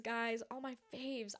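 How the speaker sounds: ordinary delivery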